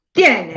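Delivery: "Tienen" with TIE hit firmly; emphatic first syllable in "Tienen"; said out loud, stressed. Shown as a demonstration. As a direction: project TIE in "Tienen", dropping on NEN. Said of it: "decirlo bien en voz alta".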